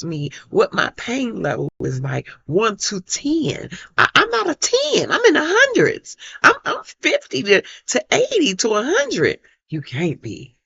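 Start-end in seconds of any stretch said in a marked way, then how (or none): 4.0-9.4 higher, louder pleading insistence; emphatic insistence. Real, not acted. Mother insisting to doctors her pain is huge, emphatic. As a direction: make them believe the pain.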